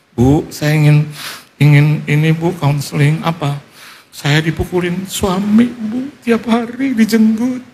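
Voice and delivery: drawn-out plaintive complaint, climbing high; imitated distressed wife. Battered wife complains to the counselor, acted plaintively. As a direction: mimic a wife's pleading, stretched-out lament.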